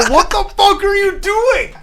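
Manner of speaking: high-pitched mock exasperation at a laughing friend; exasperated friend. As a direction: squeak it out in disbelief. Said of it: "I would go".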